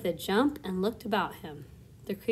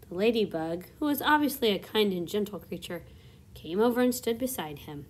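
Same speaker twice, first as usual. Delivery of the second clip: plain reading voice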